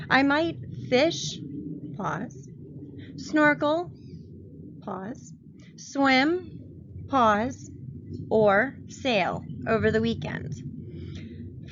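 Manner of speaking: slow down on or sail over the weekend; calling out each pause. Shown as a demonstration. Teacher modeling comma pauses for students, saying pause aloud. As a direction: list items lifted, with a dropped word pause between them. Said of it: "My pauses, boys and girls, are going to be the commas".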